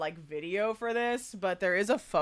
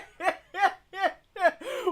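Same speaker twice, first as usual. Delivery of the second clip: delighted laugh; 9 semitones higher than usual. Real, not acted. Laughing at the clip.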